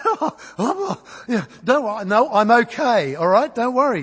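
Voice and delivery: high, laughing, stunned reassurance; shocked nervous laugh. Retold or imitated. Retelling shock after car crash.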